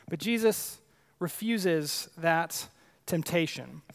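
usual speaking voice

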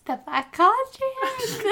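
high laughing alien voice. Pretend. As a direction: squeak the title like an alien. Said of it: "not even what it sounded like".

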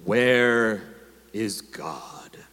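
low stern question trailing into a whisper; a looming rabbi pressing the boy, dropping to a whisper. A narrator's reading. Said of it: "asked him sternly".

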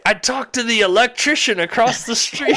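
high, excited imitation of an old Dutchman; Dutch accent. Old man reports to his daughter, high Dutch accent. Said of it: "that's definitely what the accent sounded like".

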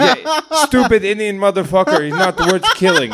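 high Nepali-accented impression through laughter; thick Nepali accent. Nepali comic quotes his would-be attackers, laughing accented impression. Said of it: "this really thick accent".

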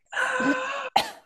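high rasping intake of breath; sharp gasp. Retold or imitated. First painful breath after revival, sharp gasp. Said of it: "breath was like".

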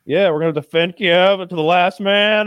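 rally like a boxer turned wartime mayor; defiant defender. Retold or imitated. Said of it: "So to see him there".

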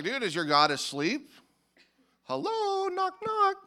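taunting, jumping to a high singsong call; mocking. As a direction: jeer at them, ending in a high-pitched call. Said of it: "He's mocking them".

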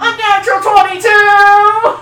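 loud, slow triumphant shout; excited cheer. Real, not acted. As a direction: cheer the big roll. Big dice roll cheer.

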